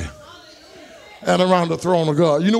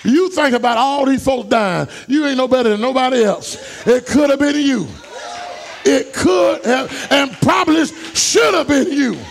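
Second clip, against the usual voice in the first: preaching at full force; 5 semitones higher than usual. Acted. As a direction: preach it out, building the fire. Preacher shouting to congregation.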